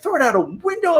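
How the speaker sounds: high, strange voice in disbelief; mock incredulous. Imagining someone's urge, high mock disbelief. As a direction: say it like an absurd idea. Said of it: "and you're like".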